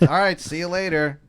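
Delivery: high, loud farewell; calling goodbye. Acted. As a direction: call out a goodbye. Player's goodbye to the driver.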